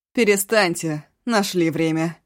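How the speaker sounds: с холодным презрением; осади их презрительно. Acted. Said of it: "презрительный голос". (презрительный тон)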